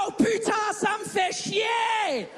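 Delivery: high, slow exasperated outburst at the phone; mock angry swearing. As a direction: rage at your phone, slowly. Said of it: "quand je suis vraiment énervé". (juron exaspéré, aigu et lent)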